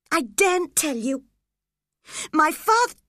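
put-on scared boy blurting about his father, mild gasp. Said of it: "the boy gasped".

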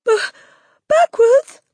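put-on panicked stammer in a very high boy's voice; Wilfred. A narrator's reading. Boy startled by the headmistress's order, high frightened stammer. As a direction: squeal it loudly, tripping on the first sound. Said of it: "stammered Wilfred".

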